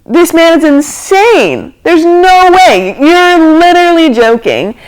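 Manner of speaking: high, drawn-out mock astonishment; stunned disbelief. Passengers react to the pilot's weightless plan, in stunned disbelief. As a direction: stretch each phrase in incredulous high pitch.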